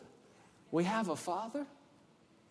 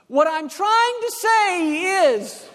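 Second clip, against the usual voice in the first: loud, slow, drawn-out words; louder for the foreigner; 9 semitones higher, 10 dB louder, slower than usual. Acted. Talking at someone who speaks no English, loud and slow. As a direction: shout it slowly, higher, as if volume translates. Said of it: "You're trying to make it louder".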